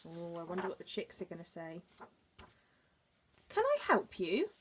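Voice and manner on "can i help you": high mother bird asking; a high, chirpy little question. Acted. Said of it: "asked the bird".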